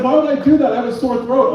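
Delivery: put-on high, indignant girlfriend; girlfriend's voice. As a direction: raise it into her protest. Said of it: "she's like".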